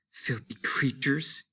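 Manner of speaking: hushed, slow whisper of disgust. A narrator's reading. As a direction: breathe it out with distaste. Wife mutters in tense scene.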